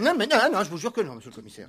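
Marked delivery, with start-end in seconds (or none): 0.0-0.6 alarmed protest. Acted. Owner protests.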